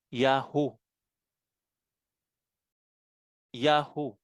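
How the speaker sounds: "Yahoo" as two clear syllables, YA-HU; joined-letter reading. Shown as a demonstration. Teacher reads the three joined letters, "Yahoo" said as YA-HU. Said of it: "ang basa doon ay".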